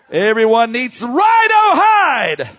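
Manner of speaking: high, loud, rising exhortation; fired-up revival preacher's yell; full-force preaching. Real, not acted. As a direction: drive it home at a shout.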